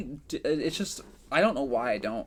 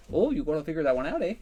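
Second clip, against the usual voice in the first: quick, higher Midwestern impression; mock Midwestern accent; 4 semitones higher, 3 dB louder, faster than usual. Teasing a friend's figure, put-on Midwestern accent. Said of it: "trying to do a Midwestern accent".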